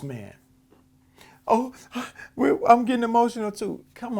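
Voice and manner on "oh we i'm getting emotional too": mock emotional, higher and hurried; fake dramatic. Mocking a TV guest's tears, fake dramatic. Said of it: "the fake dramatic".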